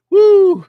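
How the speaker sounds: loud, high-pitched woo; high hype whoop. Real, not acted. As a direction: whoop like the cavalry just arrived. Cavalry hype shout.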